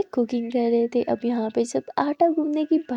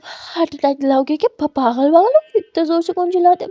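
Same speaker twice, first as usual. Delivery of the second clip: startled scolding; 3 semitones higher, 6 dB louder than usual. Acted. Scolding after being startled.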